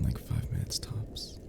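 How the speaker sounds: soft slow whisper. Acted. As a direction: let it fall to a whisper. Teasing as listener falls asleep.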